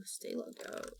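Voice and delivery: barely voiced whisper to herself; whispered aside. Real, not acted. Streamer whispers to herself while on ChatGPT, whispered aside. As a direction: hush it under your breath.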